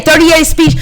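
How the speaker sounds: "Speech" said wrong, unbothered; habitual mispronunciation. Real, not acted. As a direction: say the name as "thirty-eight Speech", ending like the word speech. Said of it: "but I can't say it".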